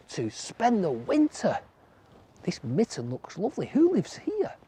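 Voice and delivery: a cold little rabbit hoping to come in. A narrator's reading. Rabbit voice in story.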